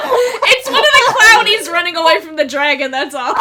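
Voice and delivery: loud, laughing through the words; giggling hard while talking. Real, not acted. Laughing about fleeing clown.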